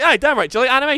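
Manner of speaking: high and loud, cheeky; eager retort. Retold or imitated. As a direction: fire it back with glee.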